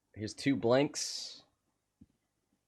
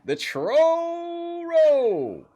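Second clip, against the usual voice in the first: very high, loud drawn-out whoop; hyped-up exclamation; 8 dB louder, much slower than usual. Pretend. Commentator announcing the troll roll to chat, wildly excited. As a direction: stretch it out in a piercing cheer.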